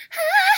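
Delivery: mock laugh. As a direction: laugh it out way up high. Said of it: "he's like".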